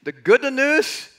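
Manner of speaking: loud, high-pitched Italian airline pilot; Italian accent. Acted. Pilot telling passengers good and bad news, hammed-up Italian accent. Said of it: "this is my Italian accent, not so good".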